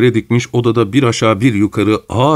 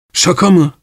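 higher, startled cry of sorrowful disbelief; sad astonished cry; 9 semitones higher, 3 dB louder than usual. A narrator's reading. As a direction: cry it out, hurt and amazed. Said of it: "diye bağırdı Pavel Pavlovich hüzünlü bir şaşkınlık içinde".

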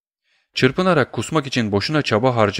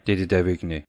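ordinary delivery, nothing marked

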